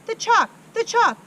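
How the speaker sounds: very high, very loud shrieking; hysterical scream. Acted. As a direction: shriek in terror at the board.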